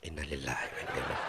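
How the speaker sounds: slipping the verse out quietly while being scolded; quick muttered recitation. Shown as a demonstration. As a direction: mumble the prayer fast under the breath as she nags. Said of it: "saya cuma ucapkan kalau mama nyicik ngomel".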